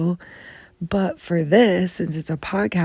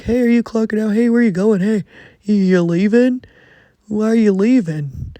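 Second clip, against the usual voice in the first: loud, higher, mocking nosy-coworker voice; 4 semitones higher, 8 dB louder than usual. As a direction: sneer it like a nosy coworker calling out. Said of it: "the stupidity of the people being like".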